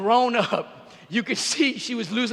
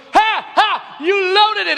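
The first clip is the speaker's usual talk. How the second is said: much higher, louder, gloating daughter; gleeful gotcha; 9 semitones higher, 5 dB louder, much slower than usual. Retold or imitated. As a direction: crow over catching him out. Daughter triumphant, retold.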